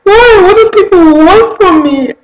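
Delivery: high, loud anguished cry; sobbing plea to God. Retold or imitated. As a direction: wail it up to God in despair. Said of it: "I cried out to God".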